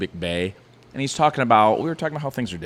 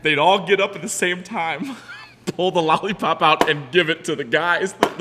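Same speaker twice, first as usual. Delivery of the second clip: high, giggly and loud; laughing; 8 semitones higher, 6 dB louder than usual. Real, not acted. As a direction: laugh through the punchline. Laughing through explicit story.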